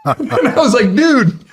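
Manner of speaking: tearful joyful laugh; laugh-crying. Real, not acted. Dad overwhelmed, laughing and crying.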